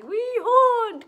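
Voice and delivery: very high, loud little ghosts; piping ghostly chorus; cried out. A narrator's reading. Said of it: "cried the two".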